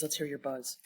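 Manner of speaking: usual speaking voice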